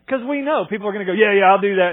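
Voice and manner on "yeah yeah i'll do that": a loud, careless brush-off yes; hammed-up flippant agreement; mock breezy promise. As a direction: toss off an empty promise loudly. Mocking unreliable group members.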